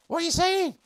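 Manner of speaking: very high, loud squawk of disbelief; mock indignation. Streamer challenges Nightwing over a remark, mock indignation. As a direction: squeal it as if affronted.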